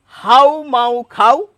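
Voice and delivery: হাউ মাউ খাও slow and nasal, rising at the end; slow nasal, rising tune; nasal pronunciation. Shown as a demonstration. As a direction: sing হাউ মাউ খাও through the nose with a lift. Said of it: "এটুকু আবার পড়ছি".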